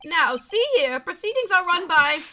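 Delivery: high, loud indignant judge; blustering judge. Acted. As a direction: protest like a flustered old judge. Voicing the blustering game judge.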